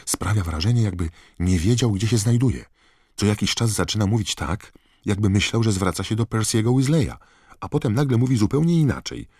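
high, loud, agitated report; urgent Harry. A narrator's reading. Harry hurrying, reporting alarming news. (przejęta relacja wysokim, głośnym głosem)

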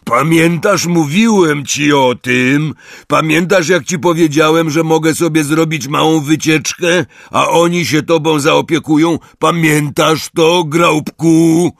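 high, loud, sustained calling; shouting. A narrator's reading. Hagrid reminding the giant of his trip, loud shouting. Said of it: "krzyknął Hagrid".

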